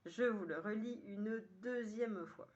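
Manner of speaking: normal talking voice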